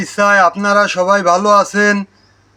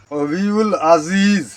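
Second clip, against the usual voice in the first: slow careful recitation; much slower than usual. Shown as a demonstration. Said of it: "এখানে একটা টান হবে".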